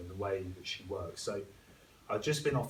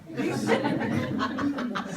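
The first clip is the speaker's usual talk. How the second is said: loud high laugh, breathy and unvoiced; real laugh; 7 dB louder than usual. Real, not acted. Laughing to viewers at the story's punchline, loud laugh. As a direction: burst out laughing at the memory.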